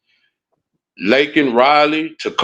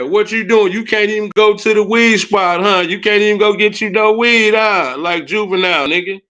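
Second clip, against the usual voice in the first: taunting; 5 semitones higher than usual. Real, not acted. Taunting trolls, rising pitch.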